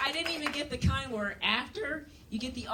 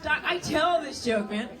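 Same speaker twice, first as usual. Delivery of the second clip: louder, laughing through the words; laughing reply; 6 semitones higher, 4 dB louder than usual. Retold or imitated. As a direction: laugh it off at the doctor. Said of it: "I start laughing".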